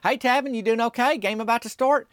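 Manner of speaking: high-pitched cheerful greeting, imitating a woman; smiling friendly woman. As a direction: voice her lightly up high with a smile. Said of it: "calm, and collected, just serving it up. She smiled".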